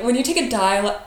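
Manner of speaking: ordinary talk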